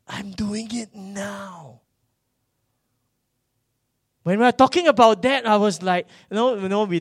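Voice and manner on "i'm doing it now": slow, breath-heavy half-whisper; hushed resolve. Retold or imitated. Preacher recalling his resolve at twenty-three, hushed and determined. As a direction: draw it out slowly in a hushed voice.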